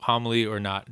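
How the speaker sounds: ordinary talk, nothing marked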